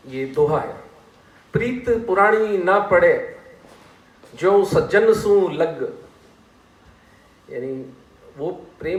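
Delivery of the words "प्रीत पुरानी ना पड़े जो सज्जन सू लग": slow, drawn-out couplet recital; raised, lilting verse delivery; poem recitation. Shown as a demonstration. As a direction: recite the couplet line by line, stretching the words. Recites a couplet between explanations.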